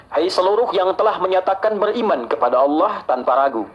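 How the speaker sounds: ordinary talk, nothing marked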